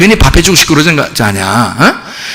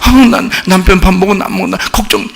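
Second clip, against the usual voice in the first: 슬픈 척; faster than usual. Pretend. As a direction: fake a tearful lament over your husband. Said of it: "그게 막 슬퍼?". (과장해서 우는소리로 슬퍼하는 척)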